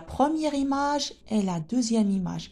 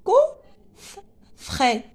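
"Coffret" as two beats, cof, fret, with a gap; split into syllables; 7 semitones higher than usual. Shown as a demonstration. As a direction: break "Coffret" slowly after the first syllable. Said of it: "on a combien de syllabes ici?". (« Coffret » découpé en syllabes, cof-fret)

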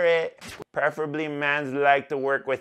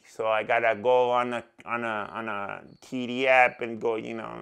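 ordinary talk, nothing marked

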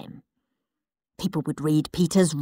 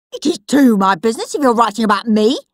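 put-on bratty boy voice; 4 semitones higher, 6 dB louder, faster than usual. Henry arguing, fast.

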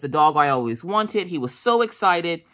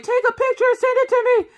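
very high, loud gushing voice; mock excited demand; 5 dB louder, faster than usual. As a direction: imitate an overexcited puppy lover. Husband excitedly demands puppy pictures.

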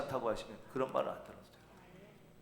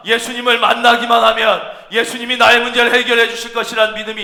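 raised, emphatic pulpit delivery; forceful preaching; 5 semitones higher, 7 dB louder than usual. Real, not acted. Preacher teaching that living faith must act, forceful. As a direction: drive the point home to the congregation.

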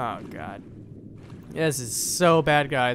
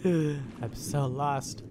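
very slow, softer lament; mock despair; 2 semitones lower, 5 dB quieter, much slower than usual. Player stuck underwater, slow and deflated mock despair. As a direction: sigh it out, dragging every word. Repeats lament after a sigh.

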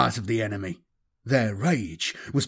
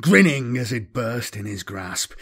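ordinary delivery

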